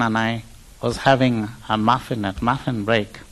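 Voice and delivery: ordinary talk, nothing marked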